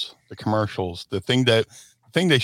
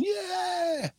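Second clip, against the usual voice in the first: high, whooping rally cry; hyped-up yell; 6 dB quieter than usual. Retold or imitated. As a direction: whoop back at the crowd. Wrestlers answering the crowd.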